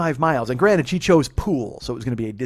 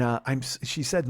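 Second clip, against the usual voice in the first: ordinary delivery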